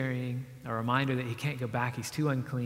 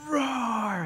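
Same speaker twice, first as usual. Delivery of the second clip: high, drawn-out mock roar; half-scary imitation of a monster roar; 9 semitones higher than usual. Preacher acting out the monster losing his temper, mock roar. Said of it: "much scarier than what I just did".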